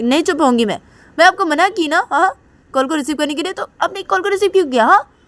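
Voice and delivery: high, loud and fast scolding; angry daughter. Acted. Daughter scolds mom for answering the call, high angry yell. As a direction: snap at mom like a furious young girl. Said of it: "काजल बोलती".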